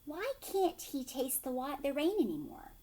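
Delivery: usual speaking voice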